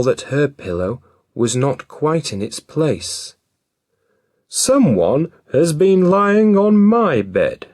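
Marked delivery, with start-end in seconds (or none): 4.5-7.7 high, loud mother bear voice; middle-sized Mother Bear voice. A narrator's reading. Mother Bear finding her pillow moved, high and loud. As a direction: lift it up, a fussy mother bear. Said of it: "she said in a middle-sized voice".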